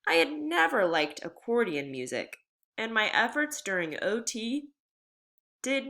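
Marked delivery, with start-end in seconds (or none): none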